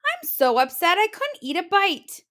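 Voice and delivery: flustered and dramatic; Mama Bear too upset to eat. Acted.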